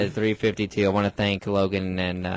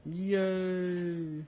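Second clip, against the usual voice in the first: high cheer; 9 semitones higher, 7 dB quieter than usual. Pretend. Mock self-applause.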